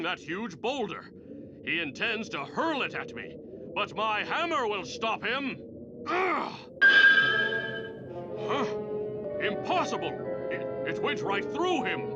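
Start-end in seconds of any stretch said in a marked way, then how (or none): 6.1-6.5 pained grunt. Acted. Hit by boulder illusion.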